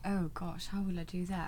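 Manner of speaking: softly spoken British accent. Real, not acted. Guest put on the spot by host, British accent. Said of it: "You already said".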